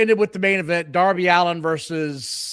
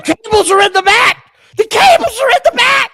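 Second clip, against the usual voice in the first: high panicked shout; screaming; 8 dB louder than usual. Retold or imitated. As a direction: shriek it like a panicked announcer. Mimicking Shivani's scream.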